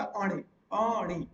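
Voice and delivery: "अणे" said very slowly, twice; slow teaching repeat of "अणे"; drawn-out model word. Shown as a demonstration. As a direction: stretch each vowel of "अणे" for learners. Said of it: "क्या होता".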